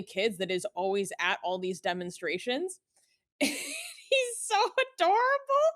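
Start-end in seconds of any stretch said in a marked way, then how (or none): 3.4-5.7 gushing. Real, not acted. Gushing about cute kid.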